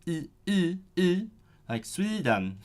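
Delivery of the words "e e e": tight, high Swedish E; Swedish E sound. Shown as a demonstration. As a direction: sing the E up high like a Swede. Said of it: "say the E sound as like a E".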